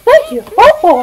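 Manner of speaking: high cartoon voice; Goofy voice. Holding the cartoon voice.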